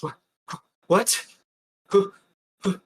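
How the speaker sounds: high, breathless, broken questions; acted panting. John hears a voice as he comes to, acted panting. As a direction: gasp out each word as if after holding your breath. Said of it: "almost panting, as if he was holding his breath".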